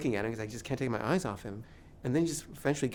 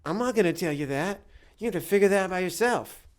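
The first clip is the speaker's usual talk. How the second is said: high, louder scolding retort; imitated telling-off, pitched up; indignant refusal; 6 semitones higher, 5 dB louder than usual. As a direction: act out an indignant man refusing to help. Acted scolding man on beach.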